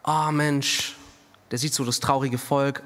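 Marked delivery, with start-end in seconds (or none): 0.0-1.0 a hint of Samuel pitying the sad people; mild mock comfort. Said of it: "da würde Samuel sagen".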